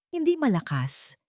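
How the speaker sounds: usual speaking voice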